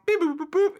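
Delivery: high, loud chirpy robot beeping; robot beeps. Retold or imitated. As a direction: beep it out like a cartoon robot. Imitating robot noises.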